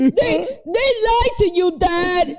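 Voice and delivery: hammed-up tearful son whining about the PS3; mock crying kid. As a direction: play the tricked child complaining to dad. Teasing voice of disappointed son.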